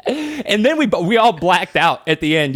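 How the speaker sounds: normal talking voice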